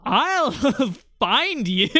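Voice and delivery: slow threat broken by laughter; laughing threat. Real, not acted. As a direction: laugh through a drawn-out threat. Teasing threat, laughs through it.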